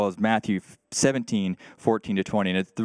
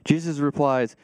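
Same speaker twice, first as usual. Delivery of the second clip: normal talking voice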